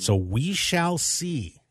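slow, drawn-out "shall"; slow teasing. Real, not acted. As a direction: let it hang, slowly. Closing a rumor, repeated.

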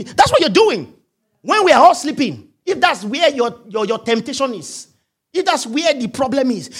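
ordinary talk, nothing marked

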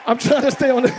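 usual speaking voice

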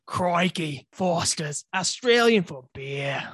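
attempted Australian accent, slow on the slogan. Shown as a demonstration. Friend obliges co-host's request for a demo, Australian accent. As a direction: give your best Australian, drawing out the slogan. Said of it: "give us your best Australian accent".

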